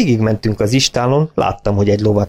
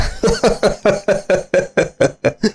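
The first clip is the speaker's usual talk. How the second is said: long, high roaring laugh; head-back guffaw; 10 semitones higher than usual. A narrator's reading. Farmer roaring with laughter, long high guffaw. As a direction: throw your head back and roar. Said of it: "Hátravetette a fejét, úgy hahotázott".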